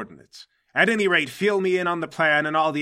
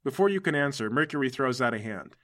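usual speaking voice

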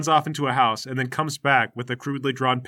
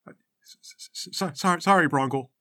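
stammering apology. Acted.

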